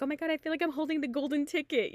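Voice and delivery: high, rapid gush; giddy excitement. Retold or imitated. Lucky winner telling the front desk about the golden ticket, giddy. Retelling joy at winning a spot.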